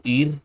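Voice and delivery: ir with the R tried rolled; rolled R try. Shown as a demonstration. Learner asks tutor whether to roll the R, trying it. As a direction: test rolling the R on ir. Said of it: "But would you roll it?".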